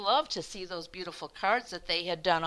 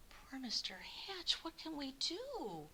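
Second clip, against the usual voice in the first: worried whisper; 7 semitones higher, 7 dB quieter than usual. Acted. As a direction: fret quietly together. Neighbours worry.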